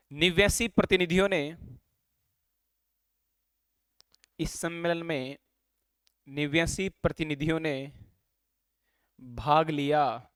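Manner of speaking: classroom dictation voice. Real, not acted. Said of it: "speed से बोलूंगा".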